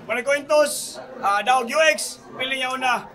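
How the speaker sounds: ordinary talk, nothing marked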